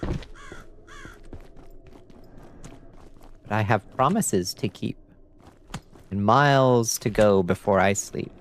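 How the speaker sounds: poem recital. Acted.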